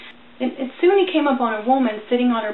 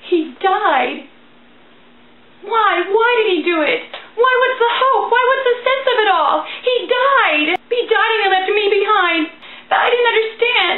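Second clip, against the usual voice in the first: anguished outcry; 7 semitones higher, 4 dB louder than usual. Acted. Preacher cries out at ragman's death.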